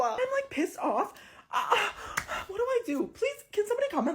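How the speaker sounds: a high pleading whine to commenters; exasperated high whine. Real, not acted. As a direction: beg the viewers for help. Exasperated plea to viewers.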